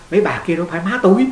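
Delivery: amused retort, laughing as you say it; light laugh on the line; laughing punchline. Retold or imitated. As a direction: quote the monk through a chuckle. Monk's punchline quote, laughing.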